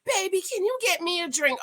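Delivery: high-pitched mock "baby" plea; put-on high voice for a quote; mimicked sweet request. Woman asking her partner for a drink, high mimicry. Voicing a cast member's request.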